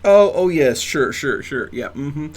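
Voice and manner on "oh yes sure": ordinary talk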